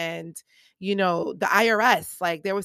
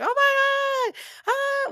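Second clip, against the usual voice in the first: much higher mock crowd gasp of delight; excited crowd squeal; 5 dB louder, much slower than usual. Imitating the crowd going crazy over her line, excited squeal. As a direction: squeal it like a thrilled audience. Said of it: "like, all excited about it".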